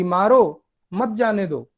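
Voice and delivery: "मारो" hit hard, brief break, then "मत जाने दो" run together; pause after मारो. Retold or imitated. Teacher showing how accent changes meaning, pause after "मारो". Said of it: "ये व्यक्ति मेरे को बोल रहा था".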